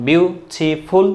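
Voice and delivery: "বিউটিফুল" split slowly into three separate syllables. Shown as a demonstration. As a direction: break "বিউটিফুল" into three slow, distinct beats. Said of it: "দুইটা বা তিনটা বা চারটা syllable". ("বিউটিফুল" তিন সিলেবলে ভেঙে ধীরে বলা)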